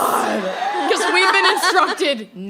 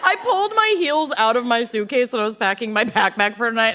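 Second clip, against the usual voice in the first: ordinary delivery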